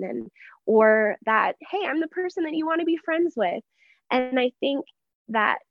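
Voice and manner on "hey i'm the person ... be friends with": higher-pitched, light mock pitch for friendship; eager self-introduction. Newcomer pitching herself to a new congregation, lightly eager. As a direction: imitate a hopeful newcomer, voice lifted high.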